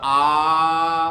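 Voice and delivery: आ held very long, triple length, slowly; prolonged pluta vowel. Shown as a demonstration. Teacher demonstrating the pluta vowel to the class. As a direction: stretch the आ out as long as three beats. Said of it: "और तीसरा है प्लुत".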